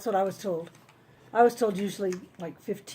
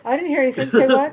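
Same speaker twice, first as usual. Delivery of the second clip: loud, higher mock innocence; playful feigned ignorance; 4 semitones higher, 8 dB louder than usual. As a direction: play dumb loudly. Pretends not to hear confession.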